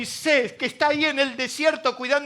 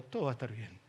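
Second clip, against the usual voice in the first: softly told; 14 dB quieter, much faster than usual. Shown as a demonstration. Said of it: "Decile al que tenés al lado".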